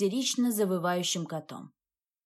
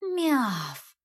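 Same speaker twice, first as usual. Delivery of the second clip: cat meow; 3 semitones higher than usual. Retold or imitated. Cat hissing in bag.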